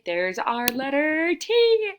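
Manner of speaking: loud, slow build to a high final letter; triumphant announcement. Real, not acted. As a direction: announce it proudly, stretching the last word. Teacher triumphantly wraps up letter search.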